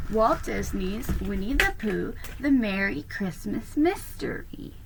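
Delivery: ordinary delivery, nothing marked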